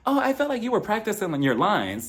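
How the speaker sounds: high, sweetened imitation; nicer version. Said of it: "she said it a little bit nicer".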